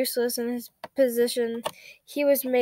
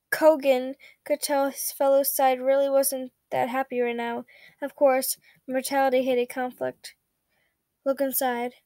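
ordinary delivery

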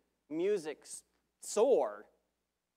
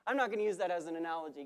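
ordinary talk

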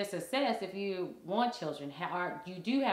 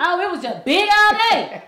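much higher and louder, laughing at the memory; big loud exclamation; emphatic loud; 11 semitones higher, 16 dB louder than usual. Real, not acted. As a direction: belt it out, reliving the noise. Recalling loud childhood house.

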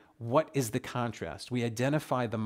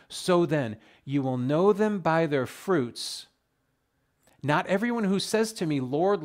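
louder, ringing pulpit reading; preached scripture reading; 3 semitones higher, 6 dB louder than usual. Shown as a demonstration. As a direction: proclaim the verse to the congregation. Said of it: "I'm gonna read it slowly".

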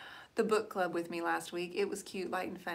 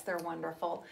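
normal talking voice

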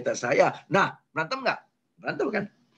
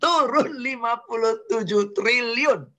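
higher, laughing repeat of the number; laughing disbelief; 7 semitones higher than usual. Real, not acted. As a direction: marvel at the huge loss with a laugh. (mengulang angka sambil tertawa takjub, nada lebih tinggi)

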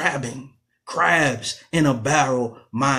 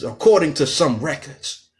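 ordinary delivery

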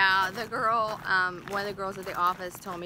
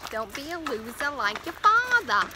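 broad, put-on New Jersey accent; 4 semitones higher, 3 dB louder than usual. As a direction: raise the pitch into a mock New Jersey accent. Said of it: "kind of a New Jersey accent".

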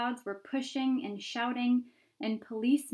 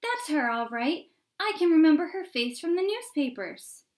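loud, higher crowd voice; shouting; 7 semitones higher, 8 dB louder than usual. A narrator's reading. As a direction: call out excitedly over the crowd. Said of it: "he heard someone shouting".

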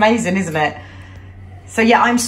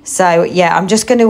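normal talking voice

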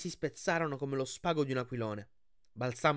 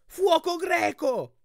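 very high, loud, slow warning cry; panicked scream of alarm; 8 dB louder, much slower than usual. Acted. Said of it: "urlò Annabeth".

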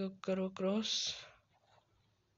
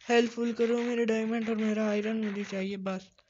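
loud, urgent demand; raised voice, pleading hard; desperate plea; 3 semitones higher, 7 dB louder than usual. Real, not acted. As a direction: cry out for what was lost. Desperate plea for lost items.

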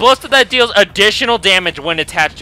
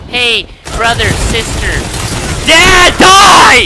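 mock caller shout; 5 semitones higher, 8 dB louder, slower than usual. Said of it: "someone always calls me and like".

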